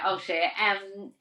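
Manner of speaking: ordinary talk, nothing marked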